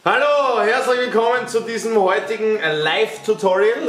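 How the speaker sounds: mock tutorial host. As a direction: play a cheesy fitness host. Hosts a mock live tutorial.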